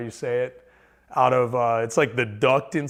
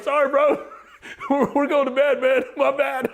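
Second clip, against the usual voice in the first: laughing; 13 semitones higher, 3 dB louder than usual. Retold or imitated. Meek apology to big guy, laughing.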